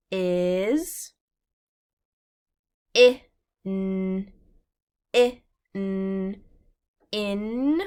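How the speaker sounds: i-n said sound by sound, then blended into in. Shown as a demonstration. Teacher sounding out in for young readers, slow. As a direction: stretch i-n slowly then say in. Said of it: "teacher sounds out words".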